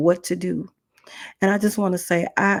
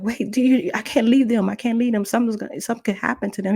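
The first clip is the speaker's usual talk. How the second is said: higher, urgent protest; panicked worry; 5 semitones higher, faster than usual. Retold or imitated. Woman fears for unwatched children, panicked high pleading. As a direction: retell frantic pleading over the children.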